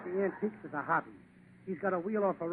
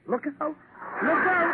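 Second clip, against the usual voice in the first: high, loud yelling "Look out!"; mock mountain yell; 11 semitones higher, 5 dB louder, much slower than usual. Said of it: "he yells".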